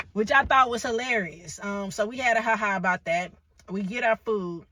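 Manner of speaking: ordinary talk, nothing marked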